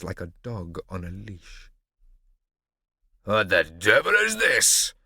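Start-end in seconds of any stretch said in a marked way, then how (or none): 3.2-4.9 slow, loud angry roar. A narrator's reading. Barbarossa roaring at the roundabout, slow and loud. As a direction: bellow it at the roundabout. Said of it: "the Redbeard roared".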